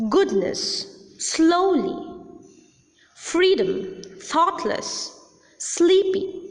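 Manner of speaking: GOODness, SLOWly, FREEdom, THOUGHTless, SLEEPy, roots pitched high; unstressed suffixes. Shown as a demonstration. Teacher gives suffix examples Goodness, slowly, freedom, thoughtless, sleepy, stressed on the root. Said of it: "suffixes are also not stressed or accented".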